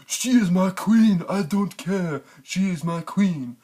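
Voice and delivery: high, slow lovesick mock Jon. Mocking Jon's devotion to Dany.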